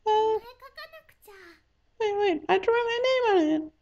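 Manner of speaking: wheedling Mayuri. Acted. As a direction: coax him sweetly for a coin.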